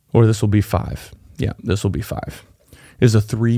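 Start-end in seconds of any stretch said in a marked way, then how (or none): 1.4-2.4 whispered, much softer, to himself; muttered aside. Real, not acted. Speaker confirming the tip number to himself, whispered aside. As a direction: confirm it under your breath.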